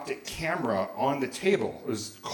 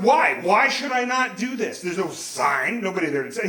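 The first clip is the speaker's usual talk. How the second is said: higher-pitched challenge, loud at first; indignant; 5 semitones higher, 4 dB louder than usual. Retold or imitated. Teenager challenging his dad's scolding, indignant. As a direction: demand a reason, offended. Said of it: "some indignant shit like that".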